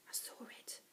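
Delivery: hushed whispered reply. A narrator's reading. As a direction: whisper back in secret. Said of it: "I whispered".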